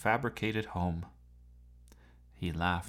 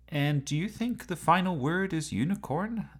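curious; 9 semitones higher, 4 dB louder than usual. A narrator's reading. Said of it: "asked Doctor Whittier curiously".

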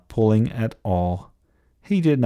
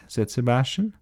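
plain reading voice

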